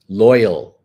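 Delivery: loyal punched out loud and long; stress on loyal. Shown as a demonstration. Coach stressing loyal to her audience. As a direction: lean hard into loyal, then let it fall. Emphasising affirmation word.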